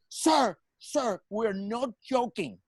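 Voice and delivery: mock indignant official, pitched high; pissed-off officer. Guard warning a man he thinks is joking, mock pissed. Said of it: "he goes really pissed".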